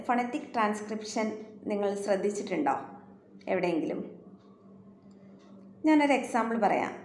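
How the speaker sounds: ordinary talk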